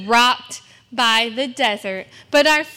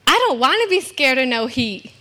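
ordinary talk, nothing marked

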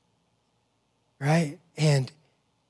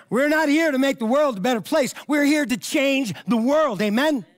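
full preaching; 7 semitones higher than usual. Acted. Pastor rallies the congregation to change the world, in a preaching shout. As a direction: ring it out to the whole church. Pastor repeats point louder.

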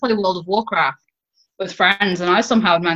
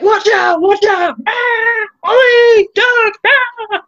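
put-on frantic high yelling in a Canadian accent; Canadian accent shouting; 12 semitones higher, 7 dB louder, much slower than usual. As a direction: yell warnings like a Canadian gamer. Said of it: "In a Canadian accent".

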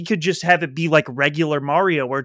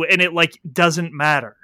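ordinary talk

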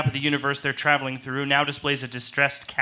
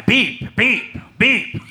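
higher, louder beeping imitation; 7 semitones higher, 3 dB louder, much slower than usual. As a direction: beep like a rising alarm. Alarm intensifying.

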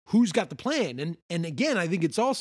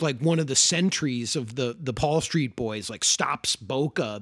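usual speaking voice

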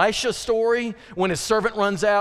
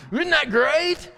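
delighted; 8 semitones higher, slower than usual. Real, not acted. Delighted with own line.

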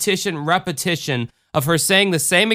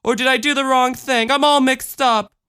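put-on high-pitched, anxious little girl; small child getting worked up; confused child; 12 semitones higher than usual. Voices the child.

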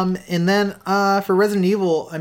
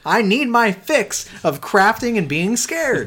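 mock desperate craving; 8 semitones higher, 4 dB louder, much slower than usual. Craving game, drawn out.

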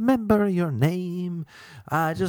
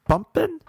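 pumping rising high; question tune; 14 semitones higher, 3 dB louder than usual. Shown as a demonstration. Host wooing women with a questioning pumping. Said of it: "I do it with a question mark".

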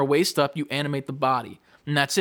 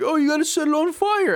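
put-on much higher, louder old man voice; game hermit explaining to the player, hammed-up; old man character; 14 semitones higher, 5 dB louder than usual. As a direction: squeak it like a quirky old hermit.

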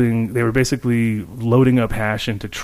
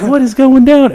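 bewildered outcry; 13 semitones higher, 8 dB louder than usual. Retold or imitated. Repeats his panicked question.

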